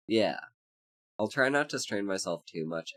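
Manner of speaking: normal talking voice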